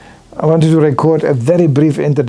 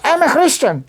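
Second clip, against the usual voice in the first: passerby's reply; 10 semitones higher than usual. Retold or imitated. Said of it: "If somebody goes".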